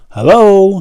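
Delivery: high, loud call into the cave. A narrator's reading. John calls into the troll's cave, high and loud. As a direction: call out to see who's there. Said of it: "he called".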